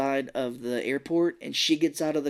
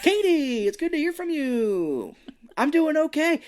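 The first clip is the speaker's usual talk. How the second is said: high, loud singsong phone voice; hammed-up cheer to drown out the caller; fake-cheery cover-up; 14 semitones higher, 5 dB louder than usual.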